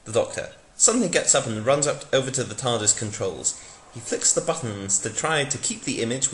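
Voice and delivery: ordinary delivery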